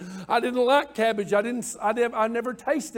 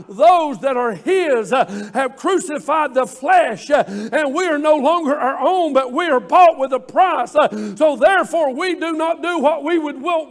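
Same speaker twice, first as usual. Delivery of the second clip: louder, building sermon climax; preaching at full force; 2 semitones higher, 4 dB louder than usual. Acted. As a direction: drive it home like a preacher rising.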